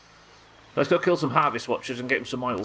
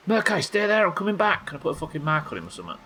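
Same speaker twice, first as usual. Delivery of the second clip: slightly panicked; 9 semitones higher than usual. Real, not acted. Player yelling at a rare monster to wait, slightly panicked. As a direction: shout it in a mild panic. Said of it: "that was slightly panicked".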